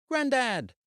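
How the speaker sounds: put-on high, thrilled little girl's shout; a small child squealing for her grandad; excited child.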